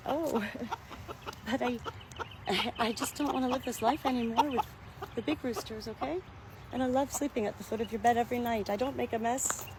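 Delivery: a hint of a soft fairy-tale princess; soft Cinderella voice. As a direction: play the hen as a gentle Cinderella. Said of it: "she's got a really soft voice, kind of like, you know, Cinderella".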